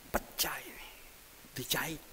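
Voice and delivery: whispered and hushed; dramatic whisper. Acted. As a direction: let it land in a whisper. Showing the injury photo.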